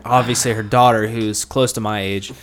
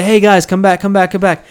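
loud, higher call after friends; 7 semitones higher, 7 dB louder than usual. Retold or imitated. Guy calling his leaving buddies back, loud. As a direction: call them back across a crowded bar. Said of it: "I literally say".

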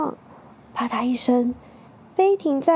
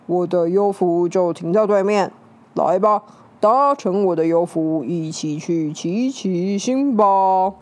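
alien villain voice; 4 semitones lower, 3 dB louder than usual. Acted. Alien villain luring people.

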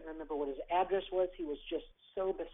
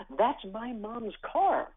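put-on excited child; 5 semitones higher, 5 dB louder, much slower than usual. Boy spots his mother's car.

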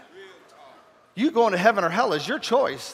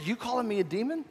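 affronted question; 4 semitones higher, 7 dB quieter than usual. Retold or imitated. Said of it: "He said".

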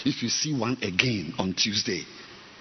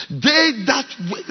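high and loud before calming down; one last loud burst; still shouting; 14 semitones higher, 8 dB louder than usual. Real, not acted. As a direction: still worked up. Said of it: "let me speak softly".

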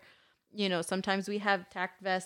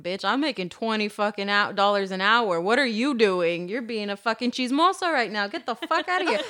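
loud, mouthy telling-off; hammed-up outrage, rising in pitch; imagined angry retort; 6 dB louder than usual. Worker telling off a nosy lady in her head, mock fury. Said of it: "I didn't say that directly".

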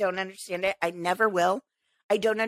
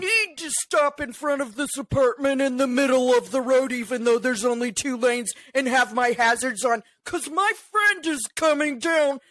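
entitled parker; 2 semitones higher than usual. Retold or imitated. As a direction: drag it out like a spoiled, self-important driver. Imitating entitled hazard-light parker.

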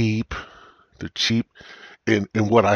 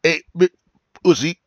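higher, louder mock yell; distorted loud quote; 8 semitones higher, 5 dB louder than usual. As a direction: blast it like a broken mic. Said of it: "it's like".

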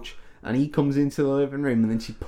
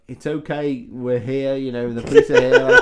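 mock calm policeman; 6 dB louder than usual. Casual policeman reassuring her.

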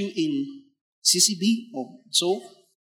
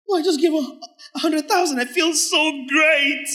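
hammed-up smug giver; mock boastful giver; 10 semitones higher, 5 dB louder than usual. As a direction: parody a proud big donor. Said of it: "No, that's not cheerfulness, sir".

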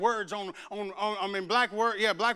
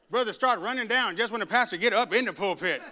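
ordinary delivery

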